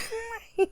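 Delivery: squealing laugh; a sudden high laugh; high burst of laughter. Real, not acted. Laughing at the movie.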